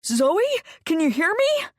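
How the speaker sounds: high, loud squeal of joy; squealing excitement. Acted. Sean squeals as Zoe opens her eyes, high and thrilled. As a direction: squeal her name in delight. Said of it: "Sean let out a squeal".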